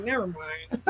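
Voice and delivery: low, soft, deflated aside; retold dismissal. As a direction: drop it softly, backing off.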